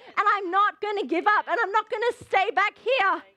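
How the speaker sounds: rousing sermon climax; fired-up preaching. Acted. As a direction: dare the devil, driving on without letup. Preaches at full force, defying devil.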